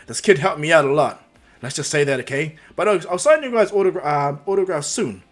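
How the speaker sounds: loud, public-facing hero voice; big, confident announcement; hearty hero. Acted. Hero addresses the crowd.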